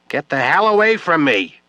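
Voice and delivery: shouting. Acted. Drunk widow screaming at Webb to back off. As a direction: scream him away from you. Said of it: "Julie shouted in a voice loud enough".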